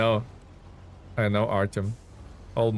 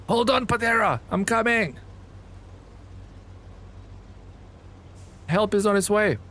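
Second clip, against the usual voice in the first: heroic rescue call; 9 semitones higher than usual. Acted. Streamer calls to his stuck truck, heroic and high. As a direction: call out like a hero riding to the rescue.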